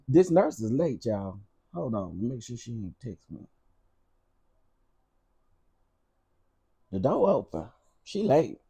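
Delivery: quiet aside. Real, not acted. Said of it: "aside about late nurse".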